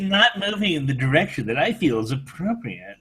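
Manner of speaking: high, slow and haughty; snooty voice. Acted. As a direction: look down your nose as you speak. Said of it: "Listen to my snooty voice".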